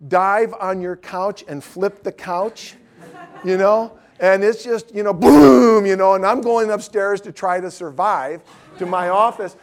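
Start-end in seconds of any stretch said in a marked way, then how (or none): 5.1-5.8 big loud drawn-out boom; booming crash noise; imitated crash. Acting out the couch crash.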